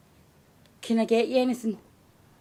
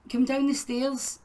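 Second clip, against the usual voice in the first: ordinary delivery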